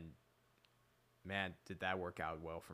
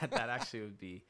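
laughing; 3 semitones higher, 5 dB louder, faster than usual. Real, not acted. Laughs while answering.

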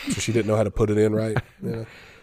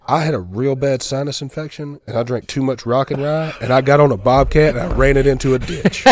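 loud retold excuse; 4 semitones higher, 6 dB louder than usual. Grandma recounts her accident.

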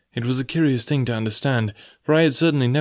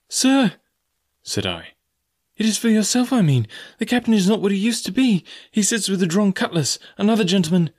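put-on frightened boy; 10 semitones higher than usual. A narrator's reading. Boy in pain begging the beggar, much higher. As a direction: cry out desperately.

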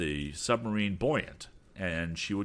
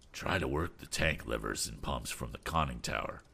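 lightly voiced captain, lower and modest; a hint of a humble old sea captain; 6 semitones lower, 5 dB quieter than usual. Captain Weston suggests.

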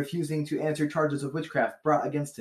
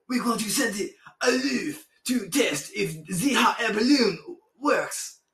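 slow, higher hammed-up French accent; 7 semitones higher, slower than usual. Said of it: "so I'll just do a French accent".

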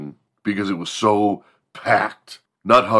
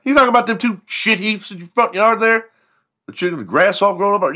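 mocking classmate; 13 semitones higher, 4 dB louder than usual. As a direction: sneer at his junk trucks. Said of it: "They'd be like".

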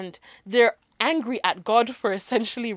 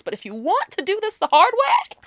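higher-pitched, drawn-out threat; mock warning; 8 semitones higher, 4 dB louder than usual. God warning people who want it the hard way, mock warning. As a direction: dangle the threat, pitch climbing.

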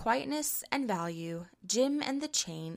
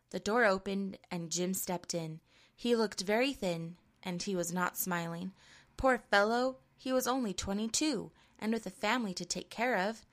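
plain reading voice

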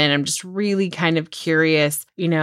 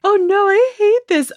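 high, louder hammed-up fake sob voice; mock fake crying; 5 dB louder than usual. Host mocks a fake crier with a high, hammed-up wail. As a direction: overdo a tearful wail, clearly fake. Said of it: "a dramatization of fake crying".

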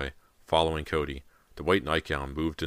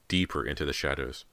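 ordinary delivery

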